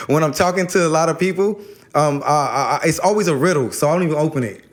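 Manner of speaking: ordinary delivery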